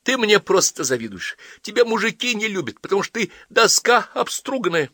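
very high-pitched taunting; spiteful outburst. Acted. Daughter insults her mother mid-quarrel, spiteful.

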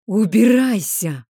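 медленный громкий звериный рёв; взреви как чудовище; нечеловеческий рёв. A narrator's reading. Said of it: "взревел Нейтан страшным нечеловеческим голосом". (страшным нечеловеческим голосом)

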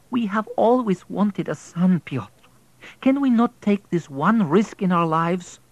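put-on a wife pleading for the boy.

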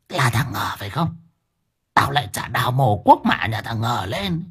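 put-on menacing old hag. Grandmother threatening the neighbour Ngờ, hissing menace. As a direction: threaten like a ghoulish old woman.

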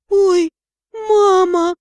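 high, loud despairing wail; moaning in dismay.

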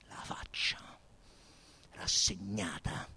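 a stunned narrator's whisper over the face; hushed whisper. A narrator's reading. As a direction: breathe it out in a whisper.

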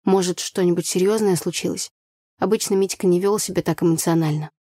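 ordinary delivery, nothing marked

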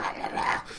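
soft throaty mock snarl; imitated growling. Changeling growling at the ponies, quiet imitated snarl. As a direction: growl without real voice. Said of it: "growling at them".

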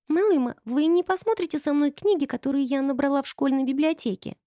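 put-on high, meek child's question; meek girl. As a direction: ask meekly like a small girl. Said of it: "кротко спросила Памела".

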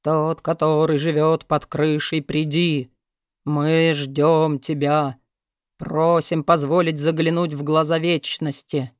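slow, low ghostly summoning voice; sepulchral voice. A narrator's reading. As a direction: intone it like calling up a spirit. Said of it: "загробным голосом начал призывать духа".

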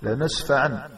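"لنسفعا" ending in an audible n; final nun sound. Shown as a demonstration. Teacher showing how it is written and spoken, final nun sound. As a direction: say "لنسفعا" with -an at the stop. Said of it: "تكتبها وتنطقها".

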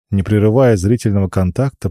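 plain reading voice

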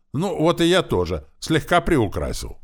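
high, loud self-justification; cheeky echo. A narrator's reading. Boy echoing orc's excuse back, cheeky echo. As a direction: toss his excuse back gleefully.

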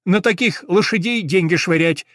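high, loud tattling sparrow; indignant sparrow. A narrator's reading. Sparrow quotes the shopkeeper against costly horses, indignant.

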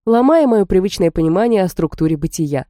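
ordinary delivery, nothing marked

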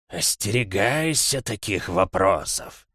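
slow, otherworldly warning; menacing monster, drawn out; eldritch horror. Acted. Существо отвечает из темноты. (медленное зловещее предупреждение чудовища)

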